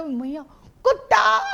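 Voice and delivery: भैया कुत्ता ऊँची आवाज़ में ज़ोर से चिल्लाकर; बच्चे की तरह ऊँचा और ज़ोर से पुकारो; loud child shout. Retold or imitated. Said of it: "जोर से बोलेगा". (भैया कुत्ता, ज़ोरदार ऊँची चीख)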